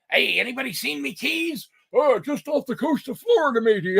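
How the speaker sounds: lightly voiced pirate. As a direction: hail the crew like a pirate. Pirate calling and answering.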